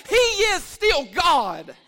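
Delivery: slow, loud, stretched-out declaration; shouted proclamation. Acted. Pastor shouting to the church, slow and loud. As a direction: hammer every word out slowly. Shouted climax then quiet repeat.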